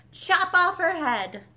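the Duchess calling for Alice's head; furious command. A narrator's reading. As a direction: bellow the order. Duchess ordering execution.

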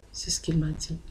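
whispered aside, no voice at all. Real, not acted. A convert whispering what Jesus told her, unvoiced.

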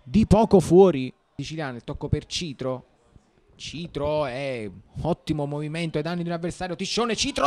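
ordinary talk

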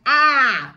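loud, high cry as if pinched. Shown as a demonstration. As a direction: yelp a long open AH. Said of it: "pinching you".

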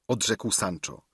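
plain reading voice

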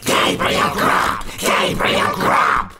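slow, harsh, screamed chant; wild screaming chorus. A narrator's reading. Wild chorus of voices fills the churchyard, screaming. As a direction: scream the name like a wild mob. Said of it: "screamed a wild chorus of voices".

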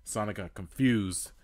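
ordinary delivery

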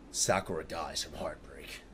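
low, hushed whisper. Real, not acted. Viewer remarking on Sakura's heartbreak, low whisper. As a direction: whisper it under your breath.

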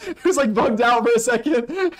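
high, loud giggling through words; laughing disbelief. Real, not acted. Gamer cracking up at a glitched creature.

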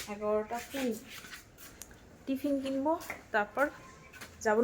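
ordinary delivery

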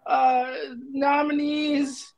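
hammed-up crying award speech; teary acceptance speech. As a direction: sob out the thank-yous. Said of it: "acceptance speech".

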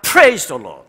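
loud praise shouted to a congregation; big, loud church acclamation; joyful shout. Real, not acted. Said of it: "I would like to shout".

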